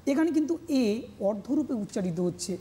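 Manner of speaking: normal talking voice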